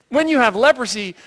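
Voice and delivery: ordinary talk, nothing marked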